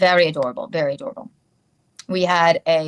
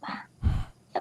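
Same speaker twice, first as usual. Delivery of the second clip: ordinary talk, nothing marked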